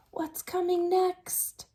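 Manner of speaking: slow suspenseful whisper. Real, not acted.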